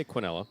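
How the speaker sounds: Quinella said "kwin-ELL-a"; alternative pronunciation. Shown as a demonstration. Announcer allowing the other option, Quinella as "kwin-ELL-a". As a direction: give Quinella the American "kw" opening. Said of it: "But you could say".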